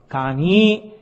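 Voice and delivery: ordinary talk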